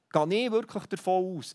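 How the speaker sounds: ordinary delivery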